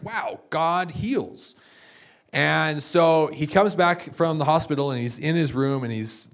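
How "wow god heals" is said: very slow, drawn-out hushed wonder; awed wonder. Retold or imitated. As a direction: breathe it out in slow amazement. Friend reading the Bible, amazed.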